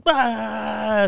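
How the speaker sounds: a short, high mock crying burst; imitated toddler wail. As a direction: mimic a little boy bursting into tears. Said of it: "Starts crying".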